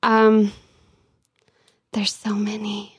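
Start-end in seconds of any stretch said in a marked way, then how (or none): 1.9-3.0 breathy whispered aside, a bit slower. Real, not acted. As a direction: drop to a whisper as if overwhelmed. Exasperated at so many bad stories.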